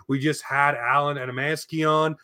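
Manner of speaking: ordinary delivery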